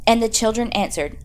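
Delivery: usual speaking voice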